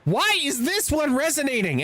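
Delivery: high, disbelieving exclamations; can't believe the numbers; incredulous. Real, not acted. Streamer reacting to his tweet's likes, high incredulous outburst.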